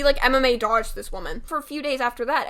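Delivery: ordinary delivery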